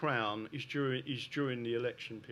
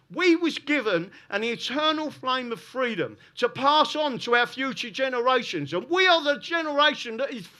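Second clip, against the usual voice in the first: loud, high, impassioned political oratory; rally speech; 8 semitones higher, 9 dB louder than usual. Real, not acted. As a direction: fire up the crowd with forceful, raised-voice conviction. Impassioned political address to audience.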